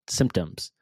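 ordinary delivery

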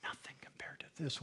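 hushed whisper after big build. Real, not acted. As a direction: lean in and breathe it. Hushed aside after loud talk.

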